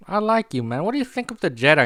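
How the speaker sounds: high-pitched, toying villain voice; mock-friendly interrogator. Reva questioning Owen the farmer, high mock-friendly voice. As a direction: question him in a high, put-on voice.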